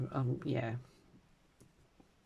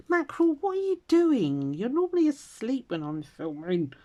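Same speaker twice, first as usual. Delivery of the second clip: surprised at cat; 11 semitones higher, 6 dB louder than usual. Real, not acted. Cat interrupts her filming.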